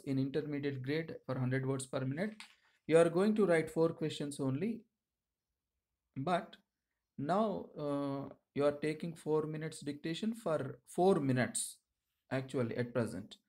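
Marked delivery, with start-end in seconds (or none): none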